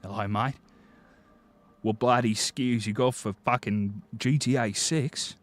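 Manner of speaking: a mock Aussie bloke asking; Australian accent. Streamer imitating an Australian leaker in a mock Australian accent. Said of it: "some Australian dingo walking in and going".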